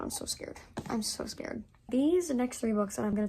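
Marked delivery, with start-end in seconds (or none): 0.0-0.6 breathy whisper of mock fright; whispered fear. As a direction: whisper it as if scared to say it aloud. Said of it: "Oh my god".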